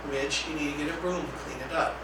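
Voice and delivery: quiet, even, unhurried instruction; very calm voice. Shown as a demonstration. Staff redirecting a challenging student, calm and quiet. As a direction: calmly repeat the directive without rising to it. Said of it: "in that very calm voice".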